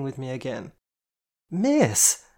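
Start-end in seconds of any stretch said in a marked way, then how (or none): 1.5-2.2 a sudden, much higher, louder cry; alarmed shout of miss; loud exclaim. Acted. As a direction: blurt it out loud enough to startle the bus. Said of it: "I exclaimed loud enough".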